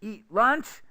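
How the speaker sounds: "Eat lunch" loud and high, both words stressed; two stressed words, nothing between; content words only. Shown as a demonstration. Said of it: "eat and lunch are the only two content words".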